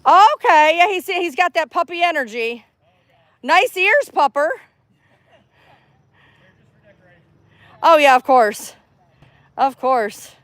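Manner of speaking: pitched well up, sweet and friendly; friendly stranger chat. Real, not acted. Greeting a dog owner at the park, high friendly voice. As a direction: lift the voice high to charm a passer-by.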